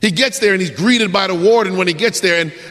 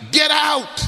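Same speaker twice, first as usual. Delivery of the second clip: sharp order; 8 semitones higher than usual. Acted. Prisoner ordering visitor out.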